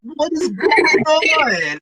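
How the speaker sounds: high, loud, drawn-out exclamation; mock bewilderment. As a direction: stretch it out in exaggerated disbelief. Acting out bewilderment after being punished.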